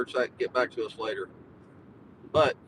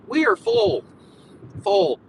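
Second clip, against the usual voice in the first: emphatic; 6 semitones higher, 8 dB louder, slower than usual. Real, not acted. Complaining about crowded Charleston.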